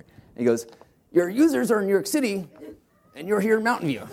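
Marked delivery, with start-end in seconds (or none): 1.1-4.0 higher, incredulous mentor voice; Paul Graham impersonation. Retold or imitated. Investor questioning founders why they're far from users, higher mocking voice. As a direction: spell out the obvious like a puzzled investor. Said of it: "I'll try to do my best Paul Graham impersonation".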